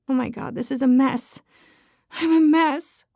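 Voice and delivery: plain reading voice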